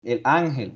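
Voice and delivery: "ángel" as ÁN-gel, long opening A; stress on first syllable. Shown as a demonstration. Teacher giving another tonic-A example, first-syllable stress. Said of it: "Ángel es tónica".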